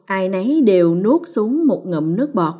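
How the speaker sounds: ordinary delivery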